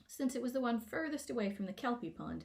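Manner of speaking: plain reading voice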